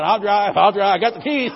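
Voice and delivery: loud, eager mock insistence. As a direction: grab the wheel, loudly. Eagerly claiming driver seat.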